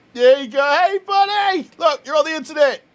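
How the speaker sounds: high, loud cooing pet voice; talking to the cat. Real, not acted. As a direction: coo up at the cat.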